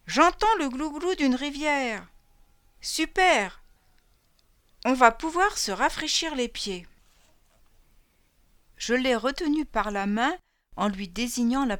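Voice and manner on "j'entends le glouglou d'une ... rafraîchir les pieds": high, eager call; calling out with delight; excited shout. Acted. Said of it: "en criant".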